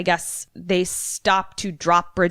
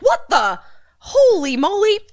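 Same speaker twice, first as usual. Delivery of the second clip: high, loud cartoon shock; hammed-up alarm of a victim; mock astonishment; 13 semitones higher, 5 dB louder than usual. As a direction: exclaim it in startled disbelief. Voicing the man tied down by roots.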